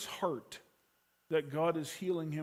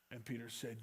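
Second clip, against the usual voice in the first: normal talking voice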